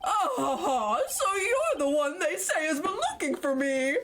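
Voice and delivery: a hint of a blustering elder lawyer greeting a visitor; pompous old lawyer Grossberg. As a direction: puff up like a self-important senior lawyer.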